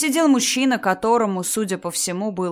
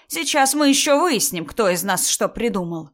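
high, loud indignant threat; threatening; 4 semitones higher, 4 dB louder than usual. Acted. Mother-in-law vowing to learn who lied, threatening. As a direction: start raised and forceful, promising a reckoning.

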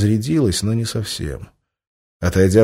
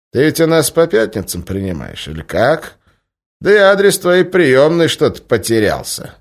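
sarcastic; 7 semitones higher, 5 dB louder than usual. Acted. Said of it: "язвительно ответил".

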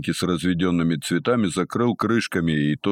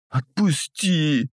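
high slow whining howl; howling like beaten dog; 9 semitones higher, much slower than usual. Acted. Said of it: "как побитая собака завыл".

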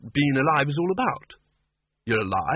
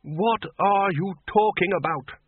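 high, slow, incredulous demand; 8 semitones higher, slower than usual. A narrator's reading. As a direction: demand an answer, drawing out each word. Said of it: "the Arch-Chancellor demanded".

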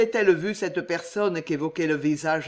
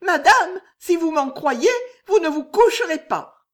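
much higher, louder, near-tearful; desperate warning; 11 semitones higher, 8 dB louder than usual. A narrator's reading. Maid Juliette warning her mistress, near tears. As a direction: blurt it out on a sudden resolve. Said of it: "fit Juliette, comme si ... résolution soudaine".

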